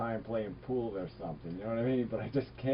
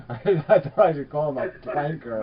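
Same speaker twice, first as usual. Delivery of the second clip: laughing; 5 semitones higher, 12 dB louder than usual. Real, not acted.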